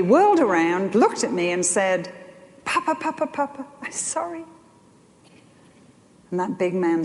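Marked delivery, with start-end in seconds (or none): 2.6-4.4 put-on much higher, softer small-child plea; a little boy begging not to be in trouble; pleading toddler. Grandson turns and pleads with grandpa, high and small.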